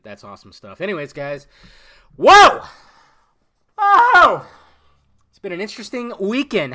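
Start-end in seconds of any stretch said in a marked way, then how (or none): 2.2-4.5 high, loud drawn-out whoops; big exclamation. Real, not acted. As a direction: holler in overblown amazement. Exclaims about the crazy weekend.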